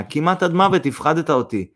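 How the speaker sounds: ordinary delivery, nothing marked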